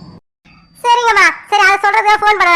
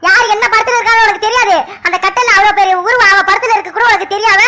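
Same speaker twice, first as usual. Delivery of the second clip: indignant mother's rapid-fire rebuke; angry scolding; 3 semitones higher than usual. Acted. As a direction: tear into your son for his mistake. Mother scolding son after his confession.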